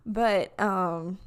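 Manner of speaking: ordinary talk, nothing marked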